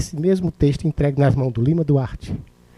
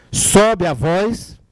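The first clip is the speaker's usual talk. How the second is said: drawn-out, higher-pitched delivery; raised voice; 4 semitones higher, much slower than usual. Shown as a demonstration. Coach showing the secret of voice, raised and stretched. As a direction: lift the voice up and stretch it. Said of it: "o segredo da voz é esse".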